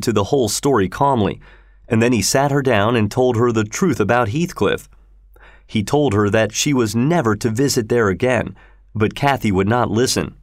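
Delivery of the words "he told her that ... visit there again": ordinary delivery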